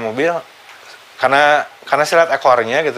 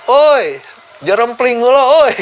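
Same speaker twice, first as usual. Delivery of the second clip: high, loud holler for silence; retold shout; 11 semitones higher, 4 dB louder, much slower than usual. Father hushing the noisy crowd at the crash, shouting. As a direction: call out over a crowd, much higher, with drawn-out words. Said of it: "dia teriak".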